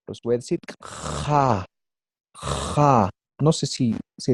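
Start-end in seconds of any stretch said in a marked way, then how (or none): 0.8-1.6 "Ja" with a long rasped fricative J; scraped J. Shown as a demonstration. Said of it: "pero raspada". 2.3-3.1 "Ja" with a long rasped fricative J; scraped J. Shown as a demonstration. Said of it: "pero raspada".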